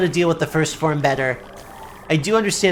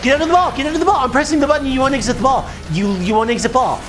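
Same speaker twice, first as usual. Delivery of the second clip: frustrated pleading; 7 semitones higher, much faster than usual. Real, not acted. Frustrated with game controls.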